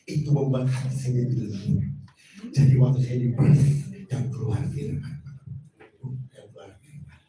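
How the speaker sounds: ordinary talk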